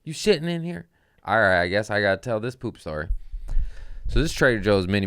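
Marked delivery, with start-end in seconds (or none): 0.1-0.9 high-pitched, softer mock challenge; retold accusing question, pitched up; mock confrontation. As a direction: call someone out in a raised pitch. Acting out confronting the coworker.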